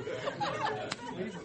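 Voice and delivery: high helpless giggle; real laughter at his own joke; high burst of laughter. Real, not acted. Congregation laughter breaks in.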